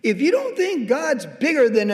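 emphatic rebuke. Retold or imitated. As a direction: lean in and scold them with conviction. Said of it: "I said".

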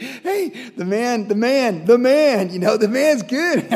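tipsy brothers. Retold or imitated. Brothers toasting Joseph at the feast, high and rising loud. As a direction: hail him like buddies over wine. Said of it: "It's like".

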